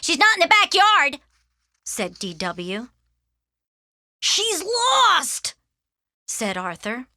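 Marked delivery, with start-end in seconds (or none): 0.0-1.2 put-on high, loud kid's yell; piping, full-voiced call; little sister calling out. Little sister yelling search report. 4.2-5.5 high, stretched cry of dismay; distressed child's cry; alarmed. Acted. Arthur panics over the lost dog.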